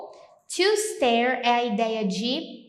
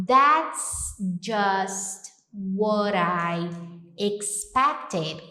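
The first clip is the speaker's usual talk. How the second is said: very slow, careful English; slow English model; much slower than usual. Shown as a demonstration. Teacher modelling the phrase slowly. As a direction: spell it out slowly for the learner. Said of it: "Então, eu posso falar".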